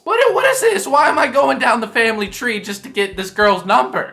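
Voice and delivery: high, loud disbelief; what is even happening here; incredulous outburst. Real, not acted. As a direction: throw up your hands in disbelief. Incredulous rant about the situation.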